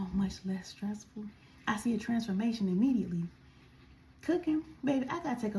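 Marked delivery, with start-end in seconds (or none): 0.1-1.2 whispered. Real, not acted. Aside about teaching.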